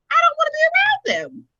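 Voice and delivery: very high, loud comic recoil; squeaky mock alarm. Joking to co-host about dark energy, high squealing recoil. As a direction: squeal it like you're spooked.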